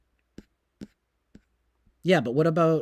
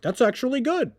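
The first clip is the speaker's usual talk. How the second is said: higher, earnest mock fan voice; mock fringe fan; 9 semitones higher than usual. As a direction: imitate a naive fan approving. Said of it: "weird fringe group of people that would be like".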